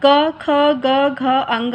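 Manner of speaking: sounds क ख ग घ spaced out one at a time, very slow, each from the throat; letter-by-letter demo. Shown as a demonstration. Teacher to students showing throat sounds, slow demo. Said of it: "इसका उच्चारण आप बोल कर देखिए".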